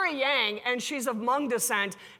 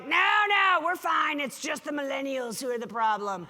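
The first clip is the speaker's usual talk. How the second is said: defensive denial; 3 semitones higher than usual. Retold or imitated. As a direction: brush it off with a raised voice. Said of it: "And they're like".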